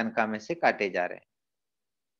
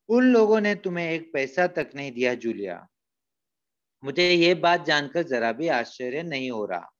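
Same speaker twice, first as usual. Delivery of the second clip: ordinary talk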